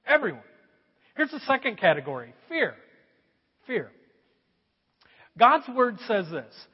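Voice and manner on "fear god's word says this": ordinary talk, nothing marked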